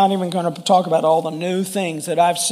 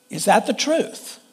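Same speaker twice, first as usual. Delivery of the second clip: ordinary talk, nothing marked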